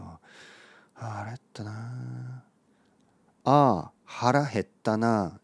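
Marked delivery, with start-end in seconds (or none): 0.0-2.4 soft slow whisper; hushed slow murmur. Shown as a demonstration. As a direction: breathe it out to yourself. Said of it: "早くこうやってひそひそ".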